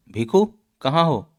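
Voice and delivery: calling out. Acted. Calling a friend who fell.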